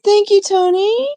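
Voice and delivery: a sudden very high, loud, sing-song thank you; chirpy high gushing thanks. Real, not acted. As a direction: squeal the thanks to the donor. Thanking a chat donor.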